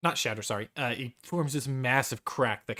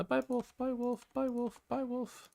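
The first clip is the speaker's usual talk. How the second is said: playful little send-off; 12 semitones higher than usual. Pretend. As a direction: squeak it out playfully in a high voice. Game master waving off removed wolves.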